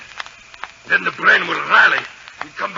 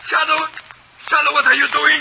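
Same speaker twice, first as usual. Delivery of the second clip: frightened; 9 semitones higher than usual. Acted. Villain panics as machine turns.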